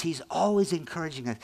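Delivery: ordinary talk, nothing marked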